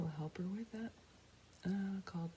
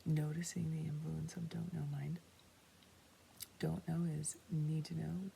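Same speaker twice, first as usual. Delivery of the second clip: ordinary talk, nothing marked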